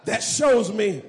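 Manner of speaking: climactic preaching shout. Real, not acted. Preacher shouting triumphantly about the empty tomb. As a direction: explode with triumphant joy.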